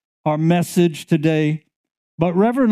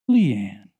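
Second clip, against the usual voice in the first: lower, tender calling of Leanne; gentle name; 7 semitones lower than usual. Retold or imitated. Jesus speaking her name to her with a smile. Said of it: "with a smile".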